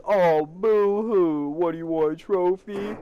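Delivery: high, drawn-out mock pity; mocking taunt. Woman taunts boy who has no parents, mocking. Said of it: "she says the most bitch thing".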